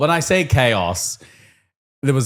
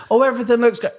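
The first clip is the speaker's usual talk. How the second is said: much higher, overdone cheery inspection; hammed-up cheerful; 12 semitones higher, faster than usual. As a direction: camp it up walking round the class. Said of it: "ham it up".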